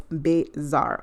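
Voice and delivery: "bizarre" stretched slowly into two separated syllables; drawn-out pronunciation. Shown as a demonstration. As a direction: draw out "bizarre", pausing between its syllables. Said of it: "Not bizarre, bizarre".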